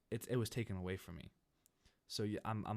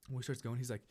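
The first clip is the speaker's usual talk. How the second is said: ordinary delivery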